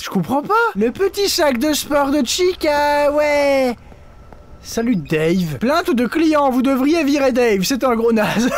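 ordinary talk

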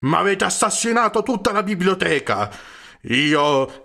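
horrified at his ruined library; horrified cry. A narrator's reading. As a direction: cry out aghast. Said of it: "gridò inorridito".

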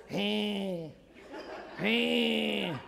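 tiny, soft drawn-out squeal; bear cub cry. Acted. Cub standing up to the jaguar, tiny squealed roar. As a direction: imitate a little cub's feeble roar. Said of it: "he lets out this".

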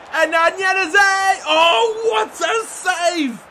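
loud, high yell, slowing on the save; real excited commentary shout. Real, not acted. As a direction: roar the name, then gasp at the save. Big chance then keeper's save.

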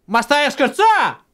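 high, loud disbelieving shout; outraged. Real, not acted. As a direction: yell it in disbelief. Outraged at a fall.

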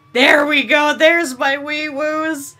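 loud, drawn-out delight; excited cheer. Real, not acted. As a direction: cheer high and loud, stretching the words. Delighted at finding the sirens.

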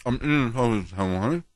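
talking through food; mouth full. Real, not acted. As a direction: speak round a bite of food. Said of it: "what are you eating".

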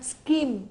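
"scheme" said skim, with a hard K; "scheme" with ch as a K sound. Shown as a demonstration. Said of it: "chúng ta đọc là âm K nhá".

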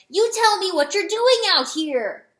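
loud, high scolding; angry demand. A narrator's reading. Rooster demanding why she is out here, angry demand. Rooster grabs and confronts the hen.

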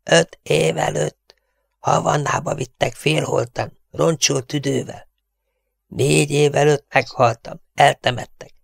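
high, strangled half-whisper; eerie piping hush; whispering distorted voice. A narrator's reading. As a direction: tell a grim tale through a squeezed throat. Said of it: "súgta a torszang".

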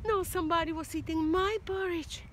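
a hint of an indignant mother bear; mock dismay from mommy bear. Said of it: "Mommy bear goes".

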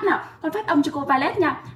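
usual speaking voice

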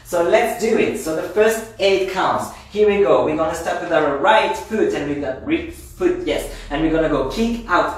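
rally the class to start dancing; line dance teacher. Acted.

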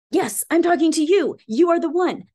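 loud, high direct address to the viewer; emphatic. Real, not acted. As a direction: drive it home to the listener. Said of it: "drive it home".

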